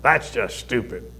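a mild bite in the voice; biting tone. Shown as a demonstration. Preacher showing how tone stings, with a little bite. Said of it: "There's that little bite in your voice".

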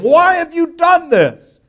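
high, loud panicked cry; frightened sailors. Acted. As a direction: sailors shouting in alarm at Jonah. Sailors panic at Jonah in storm.

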